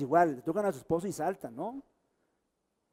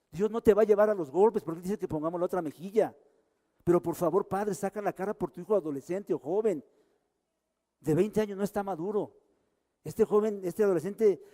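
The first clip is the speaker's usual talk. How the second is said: preaching at full force; 3 semitones higher, 4 dB louder than usual. Real, not acted. Pastor preaching to fathers, rising.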